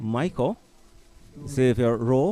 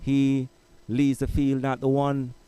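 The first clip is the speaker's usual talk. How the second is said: race caller; 3 semitones higher than usual. Acted. Live call of men's race.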